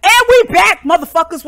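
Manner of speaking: loud comeback. Acted. Loud return from fake commercial break.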